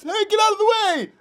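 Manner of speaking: high-pitched mock yell at kids. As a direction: call it out high, as if yelling from the car. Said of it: "it makes me yell at kids".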